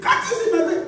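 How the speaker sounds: a sudden very high squeal of indignation. Retold or imitated. Wife replaying her own outburst, very high comic indignation. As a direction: shriek the question, comically outraged. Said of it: "I was the one just going".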